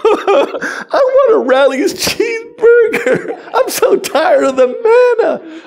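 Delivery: high, slow, whimpering fake sob; mock the Israelites as crybabies; wailing toddler voice with laughs; whiny crying child. Mocking whining Israelites.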